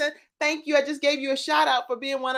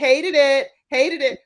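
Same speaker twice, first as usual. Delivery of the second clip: catchphrase; 6 semitones higher, 5 dB louder than usual. Shown as a demonstration. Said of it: "So if you're like".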